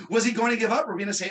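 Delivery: ordinary talk, nothing marked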